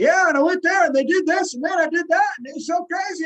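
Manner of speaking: high, loud, rushed babble; fast rambling talker. Retold or imitated. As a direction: rattle it off breathlessly fast. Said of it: "They're not like".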